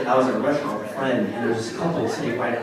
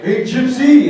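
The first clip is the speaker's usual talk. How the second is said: higher, louder cheerful taunt; mock taunt; 11 dB louder, much slower than usual. Kids greeting him with a slur, higher louder mock taunt. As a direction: call out like a grinning kid. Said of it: "Kids would come up and be like".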